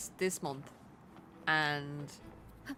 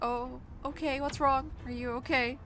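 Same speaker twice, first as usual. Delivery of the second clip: concerned reply; 8 semitones higher than usual. Retold or imitated. Couple answering the frightened neighbor.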